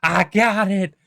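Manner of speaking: loud, high strained mock shout; exhausted rower calling. Exhausted pirate hails the ship from his rowboat, mock strained shout. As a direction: call out at the end of your strength, hammed up. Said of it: "on the brink of collapse".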